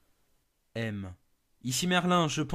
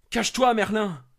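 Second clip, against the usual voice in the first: higher and louder, pressing command; urgent shout; 8 semitones higher, 10 dB louder than usual. Acted. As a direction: shout at him to hide now. Partner urges him to hide.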